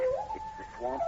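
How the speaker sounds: high, slow, breathless; stunned fear. Acted. The sergeant to the professor, spotting the creature, terrified. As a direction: gasp it out in terror.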